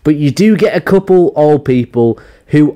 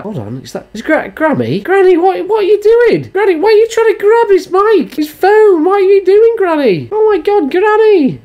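shocked grandchild. Retold or imitated. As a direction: catch your granny causing a scene and shout at her. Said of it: "and you're like".

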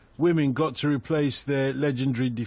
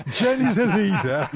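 real laughing. Real, not acted. Host cracks up reading a listener's pun, laughing. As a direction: try to read it through real giggles.

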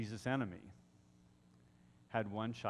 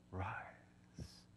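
whispered command; 6 semitones lower, 14 dB quieter than usual. Retold or imitated. Preacher voicing a command to a dead boy, whispered. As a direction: drop to a very soft whisper.

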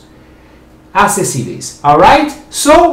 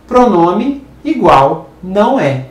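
ordinary talk

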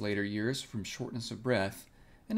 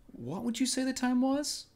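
much higher, rising voice; high-pitched query; 13 semitones higher, 3 dB louder than usual. A narrator's reading. Piglet asks Pooh the time for his excuse, high-pitched. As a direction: break off and ask in a high little voice.

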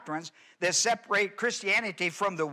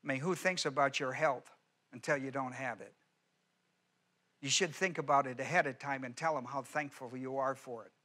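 much softer, intimate appeal; soft pleading; 4 semitones lower, 7 dB quieter than usual. Acted. Pastor gently pleading with the congregation, much softer. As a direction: lean in close and plead gently.

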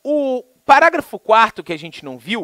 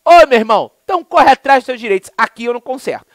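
rude brush-off; 6 semitones higher, 8 dB louder than usual. Retold or imitated. Rude clerk refusing repair.

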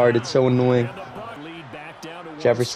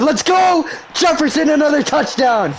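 much higher, triumphant touchdown yell; real excited celebration; 13 semitones higher than usual. Real, not acted. Gamer cheering his receiver's touchdown, excited. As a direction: yell it out like you just scored.

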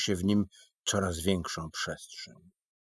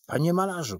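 higher, louder urgent shout; 6 semitones higher, 4 dB louder, faster than usual. A narrator's reading. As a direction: yell to snap the painter out of it. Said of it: "krzyczał pan Michaś".

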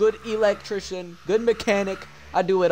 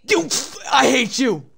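angry yell; 6 semitones higher, 5 dB louder than usual. Real, not acted. Yelling at teammate.

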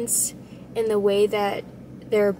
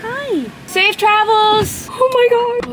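much higher and louder, drawn out; excited squeal; 11 semitones higher, 8 dB louder, slower than usual. Real, not acted. New owner holding her kittens, gushing. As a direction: gush over the kittens. Greeting kittens, thanking transporter.

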